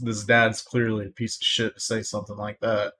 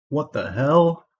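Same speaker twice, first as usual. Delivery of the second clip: a sharper, louder what the hell; louder, outraged disbelief; 5 semitones higher, 6 dB louder, slower than usual. Real, not acted. Outraged repeat of what the hell at the father's comment. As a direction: repeat it, more outraged this time.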